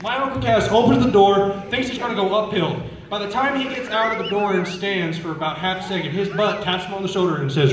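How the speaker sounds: usual speaking voice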